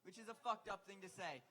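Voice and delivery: ordinary talk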